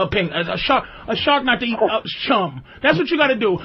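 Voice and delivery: ordinary delivery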